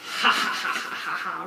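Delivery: cackling like an evil scientist; a gleeful villain's cackle; evil scientist laugh. Acted. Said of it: "we're like an evil scientist experimenting".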